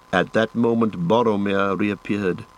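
plain reading voice

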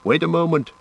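high-pitched call to halt; urgent cry. A narrator's reading. Ranger halting the rushing companions, crying out. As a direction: call out to stop them. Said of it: "cried Aragorn".

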